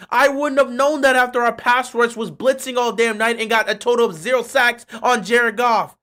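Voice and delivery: loud, exasperated sarcasm; sarcastic rant. Acted. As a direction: pile on the fake surprise in one breath. Sarcastic rant on zero sacks.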